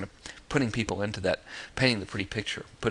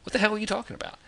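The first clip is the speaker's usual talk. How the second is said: baffled reaction; 12 semitones higher, faster than usual. Pretend. Reader's puzzled reaction to subject.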